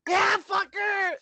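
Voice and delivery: high, slow, drawn-out jeer; mock outraged friend. Friend siding with a freshly dumped guy, high hammed-up scorn. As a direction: play the buddy trashing the ex. Acts friend cheering breakup on.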